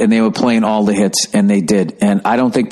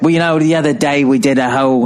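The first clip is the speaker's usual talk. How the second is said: put-on a loose, half-hearted British accent with a slightly raised pitch; 4 semitones higher than usual. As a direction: try a British rocker accent and give up on it. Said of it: "I can't do the accent".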